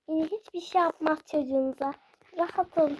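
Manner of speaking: ordinary talk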